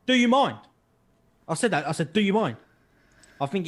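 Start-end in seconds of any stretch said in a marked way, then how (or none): 0.1-0.6 high, loud retold challenge; indignant challenge. Shopper confronts a man standing too close, loud acted indignation. 2.1-2.6 raised-voice retold challenge; indignant challenge. Shopper repeats his challenge to the man, mock indignant.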